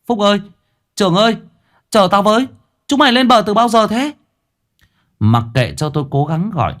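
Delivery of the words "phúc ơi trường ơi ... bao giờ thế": high, loud call across the river. Acted. Boy calling his friends from the water, loud and high. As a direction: shout after the two boys far away. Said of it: "Tôi nghển cổ gọi".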